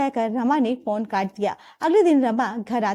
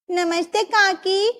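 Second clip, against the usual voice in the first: put-on child greeting; 8 semitones higher, slower than usual. Little girl greets aunt.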